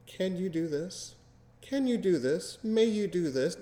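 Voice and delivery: high, slow, meek pleading; timid asking. Retold or imitated. Mocking a partner who asks in a timid high voice. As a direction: ask permission like a nervous mouse. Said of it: "the last thing your partner wants to hear".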